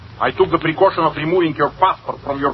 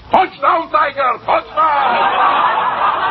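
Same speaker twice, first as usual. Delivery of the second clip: mock stadium cheer; 11 semitones higher, much slower than usual. Teasing Batsy about Princeton, mock stadium cheer. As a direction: whoop like a college football fan.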